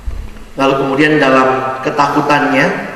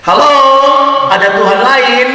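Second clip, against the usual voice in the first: high, loud call up from the pit; desperate call into the night; calling out into the dark; 9 semitones higher, 4 dB louder than usual. Acted. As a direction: shout upward, hoping someone answers. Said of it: "dia ngomong lagi".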